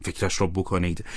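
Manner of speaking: plain reading voice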